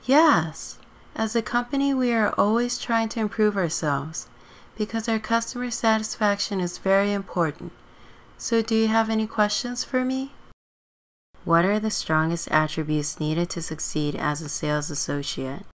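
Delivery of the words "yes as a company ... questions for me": higher, polite interviewer voice; high, professional interview questions; job interviewer. Acted. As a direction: pitch it up as a courteous hiring manager.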